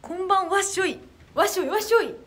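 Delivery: loud, high, festive chant of wasshoi; idol catchphrase greeting. Acted. Idol greeting fans on stream with a loud, high festive chant. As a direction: shout the greeting like a festival cheer. Idol's signature greeting call.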